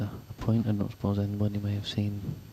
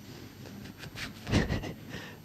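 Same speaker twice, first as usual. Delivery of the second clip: stifled laugh; 6 dB quieter than usual. Real, not acted. Commentator laughs at joke.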